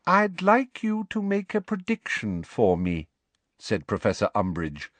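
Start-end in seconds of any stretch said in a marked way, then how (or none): 0.1-3.1 high, prim, each word crisp; very clearly. Acted. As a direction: spell it out sweetly but firmly. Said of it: "very clearly".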